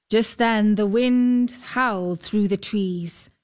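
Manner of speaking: usual speaking voice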